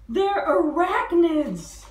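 slow, loud, high reveal; cried out. Acted. Dilly exposing the spider judges, loud slow cry. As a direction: shout it as the big discovery. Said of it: "she cried".

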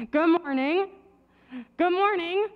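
ordinary talk

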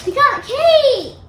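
high, loud and slow; excited. Real, not acted. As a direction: squeal it with delight. Finding cake mix.

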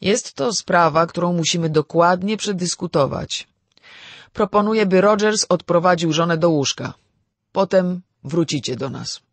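weighty, loud and deliberate; grave authority. A narrator's reading. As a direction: take charge like a judge in court. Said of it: "zaczął mówić z powagą".

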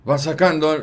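structured commentator voice. Shown as a demonstration. Echoing the Mariano Clos clip, structured commentator voice. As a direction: announce it in a firm, structured voice. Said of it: "es una voz más armada".

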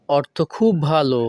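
normal talking voice

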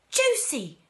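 high, loud call of her name; shout. A narrator's reading. Grandpa Joe calling to his shrinking wife, shouting. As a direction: shout out in acted alarm. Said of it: "shouted Grandpa Joe".

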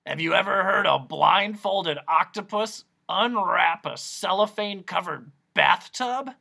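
loud, eccentric hoarse questioning; croaking inquiry. A narrator's reading. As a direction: quiz them loudly in a croaky voice. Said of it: "he inquired again".

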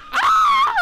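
long high shriek; real shriek, half playful; scream. Real, not acted. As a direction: scream along with the monster. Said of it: "I'll scream with you".

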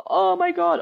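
high-pitched mock wail; upset child. Child falling off the bike, mock-distressed. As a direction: squeal it like a child in pretend panic. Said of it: "falling over, then".

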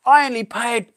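high, bragging delight in the friend's voice; excited friend. Retold or imitated. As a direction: show off the new find like a kid. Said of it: "He sent me some pics and says".

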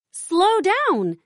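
high call to slow down. A narrator's reading. Daisy calls after Ella chasing a squirrel, high call. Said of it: "says Daisy".

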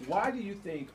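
ordinary talk, nothing marked

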